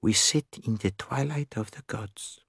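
hushed, measured Poirot musing; murmured. A narrator's reading. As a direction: murmur it gravely and softly. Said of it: "Hercule Poirot murmured".

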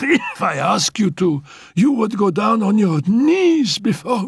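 high, laughing taunt; mocking command. Annette taunts Félicie to kneel, high and laughing. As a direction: tease her down to her knees.

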